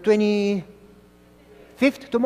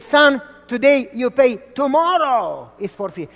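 vendor; 5 semitones higher, 5 dB louder than usual. Retold or imitated. Vendor repeats slogan.

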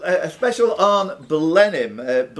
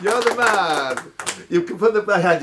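higher, showy host voice calling for applause; game show host hype; 6 semitones higher than usual. Acted. Quizmaster calling applause for Scott like a show host. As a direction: sell it like a TV quiz host. Quizmaster hyping a player's correct answer.

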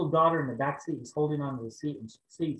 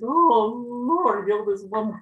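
high, loud cry to the Lord; loud nervous plea; 8 semitones higher, 8 dB louder, much slower than usual. Retold or imitated. Nervous preacher crying out over the paralyzed woman, loud plea. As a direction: blurt the prayer out in nerves.

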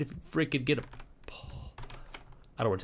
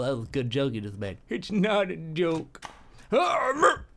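ordinary talk, nothing marked

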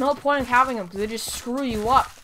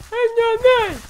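mock voice; 8 semitones higher, 6 dB louder than usual. High mock voice.

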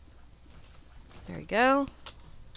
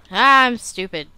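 loud, higher self-scolding; exasperated outburst; 5 semitones higher, 13 dB louder than usual. Real, not acted. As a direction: burst out, annoyed at your own mistake. Scolds self after game mistake.

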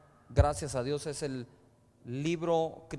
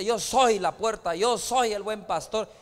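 louder and slower, declaring each claim; bold proclamation; 3 semitones higher, 8 dB louder than usual. Retold or imitated. Preacher voicing Jesus' claims to the congregation, slow loud proclamation. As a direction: proclaim it like Jesus announcing who he is.

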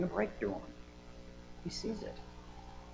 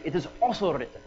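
put-on Indian accent, higher and deliberate; 4 semitones higher, 4 dB louder than usual. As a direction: imitate an Indian evangelist pressing the point. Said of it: "I'll try to throw a little Indian accent".

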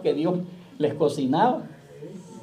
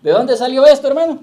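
higher, much louder, called out to the congregation; shouted out loud; 6 semitones higher, 10 dB louder than usual. Shown as a demonstration. Preacher shouting the question at the congregation, full voice. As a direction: shout the question so the whole church hears. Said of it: "grítelo".

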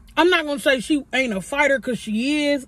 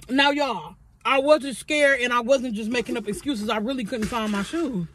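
usual speaking voice

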